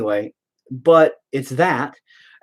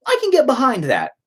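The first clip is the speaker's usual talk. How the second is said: mock agreement; 11 semitones higher than usual. Mock retort quoted at the end.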